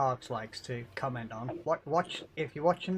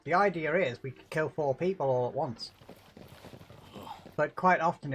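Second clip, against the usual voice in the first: normal talking voice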